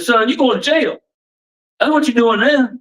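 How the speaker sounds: high-pitched, taunting retold jailer; mocking jailer. Jailer laughing off the inmate's question, mocking. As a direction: imitate a jailer scoffing at a naive kid. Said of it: "he looks at me and laughs".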